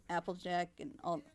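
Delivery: usual speaking voice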